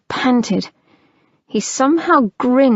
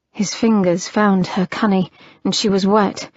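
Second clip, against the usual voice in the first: ordinary delivery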